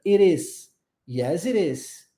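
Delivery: "It is" joined with a flapped T, like "i-Ris"; linked it is. Shown as a demonstration. Teacher linking "it is" for students to repeat. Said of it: "a gente junta".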